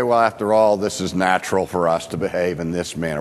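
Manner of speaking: low, quick imitated rationalizing; offhand self-excuse. Voicing people excusing their sin.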